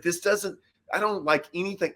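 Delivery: mock-upset whine about fishing; high complaining whine. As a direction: complain like a fussy kid. Complaining to Luke.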